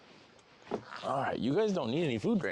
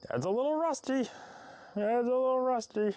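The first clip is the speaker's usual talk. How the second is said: much higher sing-song; high pet voice; 13 semitones higher than usual. Real, not acted. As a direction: say it like talking to a pet. Baby talk.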